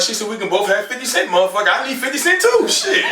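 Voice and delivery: rising high while laughing; high laughing punchline. Retold or imitated. Comic answering the stranger, breaking into laughter.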